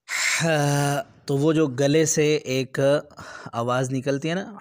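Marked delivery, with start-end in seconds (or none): 0.1-1.1 हा breathed out like after cold water; satisfied throat ha. Shown as a demonstration. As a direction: sigh हा out with relish from the throat. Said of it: "तो आप करते हैं हा".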